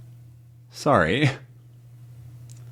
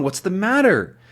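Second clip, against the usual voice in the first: ordinary talk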